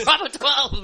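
put-on high, loud tavern drunkard's call; rowdy fantasy barfly. Co-host mocking friend as background tavern patron, hammed-up holler. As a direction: holler it from the back of the bar. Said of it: "like the guy in the back of".

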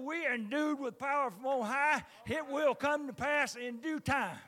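loud, rising preacher's cry to the church; preaching at full force. Acted. Preacher testifying to church about burning, loud. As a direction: preach it out loud, building fire.

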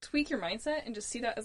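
ordinary talk, nothing marked

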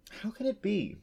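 high, bewildered question; wondering. A narrator's reading. Unicorn asking herself about men, wondering. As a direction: puzzle over it in a high voice. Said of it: "she wondered".

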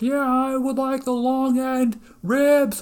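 high, slow holler from far back; yelling customer. Retold or imitated. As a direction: shout the order across the room. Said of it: "yelling across".

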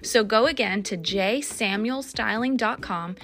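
upbeat sponsor read over music. Acted. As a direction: sell it like a friendly host.

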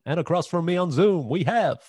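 big radio-host welcome, slowly naming himself; announcer intro. Shown as a demonstration. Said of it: "pressure now to do an accent".

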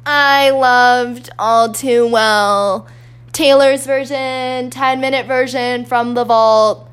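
loud, high, drawn-out mock proclamation; exaggerated slow, loud announcement; mock gushing fan. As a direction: belt it out slowly like an over-the-top fan. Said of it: "this isn't me being like".

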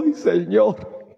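a worn-out believer moaning to God; tearful sigh. Retold or imitated.

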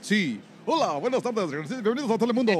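mock Telemundo news anchor greeting; Spanish TV anchor. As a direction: play a Spanish-language newscaster opening the show. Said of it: "I'll be like".

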